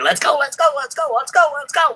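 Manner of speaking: very high squeaky cheering gnome voice; tiny gnome jockey. Retold or imitated. Tiny gnome on a chicken cheering it on, high and squeaky. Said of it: "some little teeny tiny gnome on top of a chicken going".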